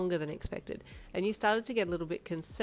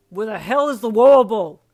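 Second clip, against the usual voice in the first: much louder angry shout; yell; 4 semitones higher, 18 dB louder than usual. A narrator's reading. Darby yelling at his drunk team, loud. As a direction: bellow it across the bar. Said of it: "he yelled loudly".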